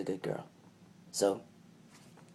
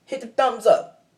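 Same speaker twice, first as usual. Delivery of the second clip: high, loud, quicker repeat; raised hype-up call; rallying call; 10 semitones higher, 5 dB louder than usual. Real, not acted. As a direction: call it out to the viewers without stretching it.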